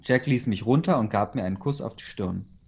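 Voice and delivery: usual speaking voice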